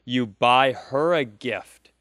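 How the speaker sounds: ordinary talk, nothing marked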